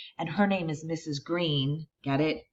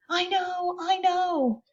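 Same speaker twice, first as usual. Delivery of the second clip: put-on eager kid; slower than usual. Boy eagerly raises his hand.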